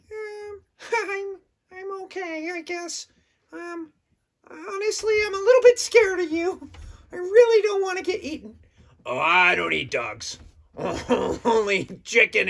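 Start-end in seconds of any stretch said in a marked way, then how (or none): 0.1-8.6 very high, shy little dog voice; high timid puppet, afraid of the shark; timid puppy puppet. Acted. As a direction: squeak it out like a nervous puppy.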